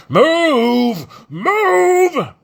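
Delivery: very high, loud bellowed order; bellowing. Acted. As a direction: bellow it like a foreman. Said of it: "Gaz bellowed".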